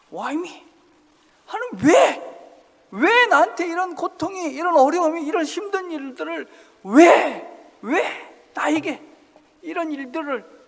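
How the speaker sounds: high, loud anguished yelling; shouting at God. Acted. Cartoon man yelling at God from the stormy sea, loud and high. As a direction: hurl the why at heaven. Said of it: "소리를 지르는 거죠".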